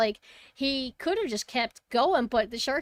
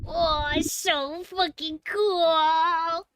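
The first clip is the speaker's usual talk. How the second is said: higher, long drawn-out gushing; awestruck; 6 semitones higher, 4 dB louder, much slower than usual. Real, not acted. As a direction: stretch every word in awe. Awed by the shark footage.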